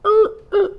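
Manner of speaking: high buzzing er sound; wrong-answer buzzer noise; mock buzzer. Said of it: "I was like".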